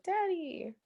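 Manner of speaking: put-on high, soft childlike Daddy; a small child calling for his dad; Kyle crying Daddy. Kyle panicking, calls out softly for his dad. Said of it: "Kyle is like".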